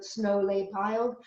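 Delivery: plain reading voice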